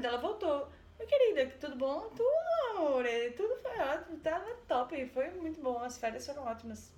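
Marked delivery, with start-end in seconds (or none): 1.0-2.1 mock sweet husband. Marido voltando, cumprimento fingido. 2.1-6.9 nervous lie. Retold or imitated. Esposa mentindo nervosa ao marido.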